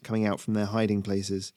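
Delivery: ordinary delivery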